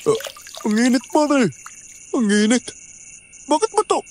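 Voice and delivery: put-on slurring drunk rambling; wasted and babbling high; blackout drunk. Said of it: "lasing na lasing ka".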